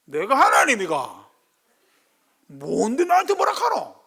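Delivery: loud, slow, angry Gyeongsang dialect rebuke. Acted. Jacob scolding Rachel in Gyeongsang dialect, loud and slow. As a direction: snap it back in thick Gyeongsang dialect, climbing high. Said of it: "경상도 말로 해볼까요?".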